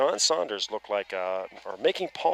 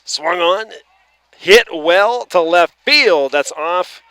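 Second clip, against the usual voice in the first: loud, higher, drawn-out hit call; excited call; 6 semitones higher, 11 dB louder, slower than usual. Real, not acted. Play-by-play man calling a long hit, excited. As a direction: stretch the call as the ball flies.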